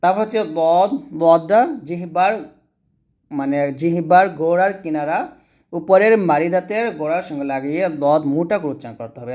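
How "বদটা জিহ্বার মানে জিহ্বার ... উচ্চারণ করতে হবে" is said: usual speaking voice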